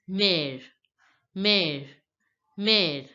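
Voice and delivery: "mère" with open è, like "mair"; French pronunciation. Shown as a demonstration. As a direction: repeat "mère" as mair, with pauses between. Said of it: "pronunciation of this word mother becomes".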